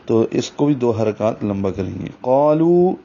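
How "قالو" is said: قالو with a full heavy qaf and long alif. Shown as a demonstration. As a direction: say قالو thick and drawn out. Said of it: "قاف کو موٹا پڑھیں گے".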